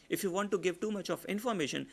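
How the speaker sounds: quick and brisk; fast informative delivery. Shown as a demonstration. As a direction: rattle through the information. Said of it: "I talked very fast".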